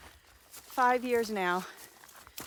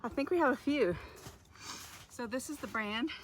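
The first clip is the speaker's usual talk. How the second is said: out of breath; 4 semitones higher than usual. Real, not acted. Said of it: "Sorry, I'm so out of breath".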